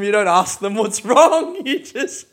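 normal talking voice

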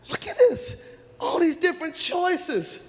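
high, drawn-out wail; acted helpless pleading; desperate girls. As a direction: cry it out slowly, overwhelmed. Girls overwhelmed, desperate to choose.

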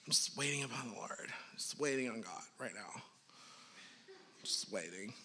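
lightly mock someone waiting on God, trailing off; mock pious waiting. Mocking a passive waiting attitude.

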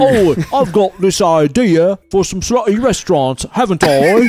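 high put-on Cockney accent. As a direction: chat in Cockney. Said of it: "in your Cockney accent".

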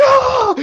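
loud, very high mock scream; imitated scream. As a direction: shriek like a terrified rider. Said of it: "Alexis starts screaming".

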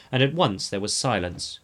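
ordinary delivery, nothing marked

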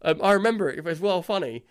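normal talking voice

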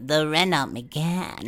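Erica. Acted. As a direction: draw out the car name in a put-on voice. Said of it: "almost the way she kind of".

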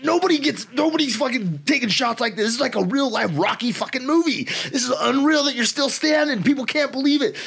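rally the beaten fighter, shouting with wild excitement; hyped shouting. Retold or imitated. Drunk corner man shouts at his fighter that the crowd loves it, excited yell.

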